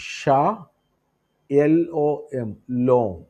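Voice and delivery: ഷാ, then letters, then ലോം, each apart; spelled-out pronunciation. Shown as a demonstration. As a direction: give ഷാ and ലോം as separate syllables. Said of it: "ചേർത്ത് പറയുന്ന മൂന്നാമത്തെ വേർഡാണ്". (ഷാ, ലോം അക്ഷരം പിരിച്ച്)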